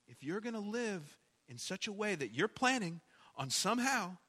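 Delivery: ordinary talk, nothing marked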